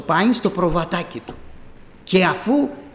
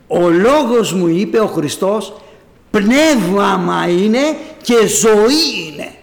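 slow, loud, every word weighted; emphatic quote; 5 dB louder, slower than usual. Acted. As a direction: lean hard on each word slowly. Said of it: "preacher stressing Christ's words". (αργά, δυνατά, με έμφαση σε κάθε λέξη)

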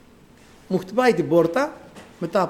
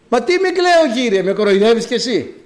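high, louder voice of disbelief; acted indignant protest; 6 semitones higher, 4 dB louder than usual. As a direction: protest as if being made fun of. Acts mother protesting to the Lord. (αγανακτισμένη διαμαρτυρία)

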